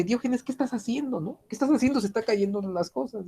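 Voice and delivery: a passerby startled by what he sees; alarmed townsperson. Retold or imitated.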